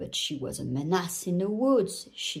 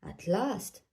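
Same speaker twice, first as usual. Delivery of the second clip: ordinary delivery, nothing marked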